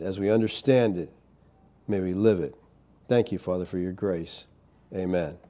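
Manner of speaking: soft, hushed closing prayer. Acted. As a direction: pray humbly, voice sinking low.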